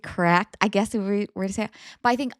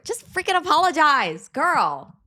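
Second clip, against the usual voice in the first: louder, fed-up push on "freaking"; exasperated; 5 semitones higher, 5 dB louder, slower than usual. Real, not acted. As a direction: snap it out, annoyed. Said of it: "I'm mad".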